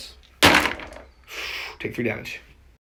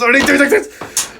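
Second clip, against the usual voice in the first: higher, slow, drawn-out wail; exaggerated dismay; 13 semitones higher, 7 dB louder, slower than usual. Real, not acted. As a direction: wail it in mock despair. Repeated dismay at dice.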